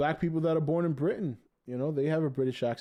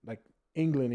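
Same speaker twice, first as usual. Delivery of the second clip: usual speaking voice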